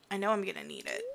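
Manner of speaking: normal talking voice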